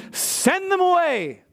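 "Send them away" said high and slow, ordering; a disciple bossing Jesus, high and drawn out; commanding disciple. Acted. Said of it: "commanding Jesus".

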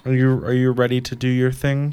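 ordinary delivery

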